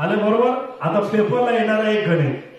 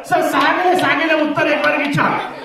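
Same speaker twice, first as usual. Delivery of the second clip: cheeky student joke; 7 semitones higher than usual. Retold or imitated. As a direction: play the cheeky kid cracking a joke to sir, laughing at the end. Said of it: "एका मुलाने काय joke केलं".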